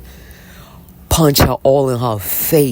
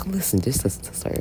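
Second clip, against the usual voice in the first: fast hushed whisper; 3 semitones higher, 8 dB quieter, much faster than usual. Real, not acted. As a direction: whisper it quickly. Hushed aside to viewers.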